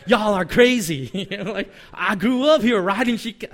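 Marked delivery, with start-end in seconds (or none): 0.1-1.1 hammed-up Southern protest; Southern accent. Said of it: "coming from the South". 1.9-3.5 higher, louder attempt at a Southern accent; badly faked Southern twang. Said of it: "I don't have a good Southern accent".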